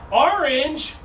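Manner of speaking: put-on high, bright joke punchline for the kids; spring the joke on them, up high; playful joke. Teacher springing the orange joke on the kids, playful and high.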